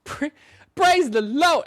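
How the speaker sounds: high, loud Southern "praise the Lord"; Southern preacher accent. Retold or imitated. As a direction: shout it like a Southern revival. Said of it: "Santa Southern accent".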